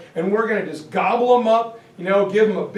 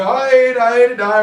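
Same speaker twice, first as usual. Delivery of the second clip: louder, rowdy beer hall cheer; mock beer hall chant; drinking cheer; 4 semitones higher, 8 dB louder than usual. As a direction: cheer like drunk Germans with steins.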